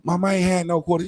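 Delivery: put-on high, fast, soft kid's denial; a child hurriedly insisting he's innocent; pleading kid. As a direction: squeak out a scared kid's lie. Kid denies to mother.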